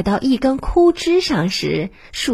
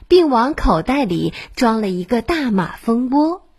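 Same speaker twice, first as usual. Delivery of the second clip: ordinary delivery, nothing marked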